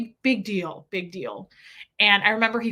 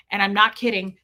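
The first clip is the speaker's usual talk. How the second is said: usual speaking voice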